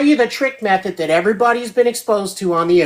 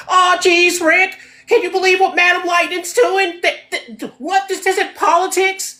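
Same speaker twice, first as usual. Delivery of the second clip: high, stammering nervous Morty voice; Morty impression; 12 semitones higher than usual. [name] mocking Morty's nervous stammer. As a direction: squeak it out like a jittery Morty. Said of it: "a horrible accent".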